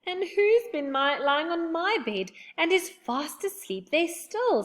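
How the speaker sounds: high, slow little bear; small baby bear voice, high. A narrator's reading. Baby Bear's line.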